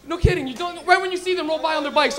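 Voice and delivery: ordinary delivery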